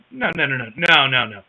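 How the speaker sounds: ordinary talk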